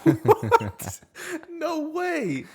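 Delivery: high, laughing disbelief; surprised. Real, not acted. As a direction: react in shocked amusement. Reacting to butter like cookie dough.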